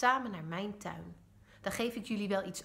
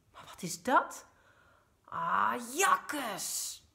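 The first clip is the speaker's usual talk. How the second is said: higher and louder, shocked then grossed out; disgusted outcry; 3 semitones higher, 5 dB louder, much slower than usual. A narrator's reading. Mama finds the snails in the shed, disgusted and shocked. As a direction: recoil at something slimy. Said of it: "roept mama".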